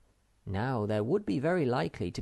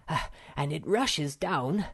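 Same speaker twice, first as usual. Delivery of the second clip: puffing; 6 semitones higher than usual. A narrator's reading. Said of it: "he puffed".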